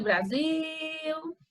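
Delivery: BRASIL with "sil" held very long and high; stress on last syllable. Shown as a demonstration. Teacher proving the tonic syllable, drawn-out stressed ending. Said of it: "a sílaba tônica é zil".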